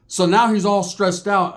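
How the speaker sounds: ordinary talk, nothing marked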